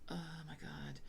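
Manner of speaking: breathy whispered aside; whispering. Real, not acted. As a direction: whisper it under your breath. Quiet aside to herself.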